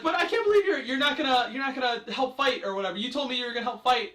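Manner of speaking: high, loud imitation of her protest; mock indignant heroine. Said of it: "she's like".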